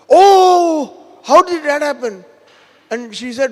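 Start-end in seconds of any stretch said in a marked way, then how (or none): 0.1-2.2 loud, high, drawn-out oh of shock; shocked outcry. Retold or imitated. Mother-in-law hears of the snakebite, shocked outcry. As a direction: cry out in acted dismay.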